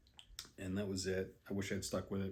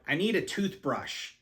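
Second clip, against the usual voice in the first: insistent request; 6 semitones higher, 4 dB louder than usual. Retold or imitated. Friend asking pharmacist, frustrated.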